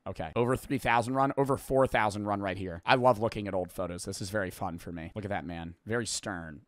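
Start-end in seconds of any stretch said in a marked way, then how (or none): none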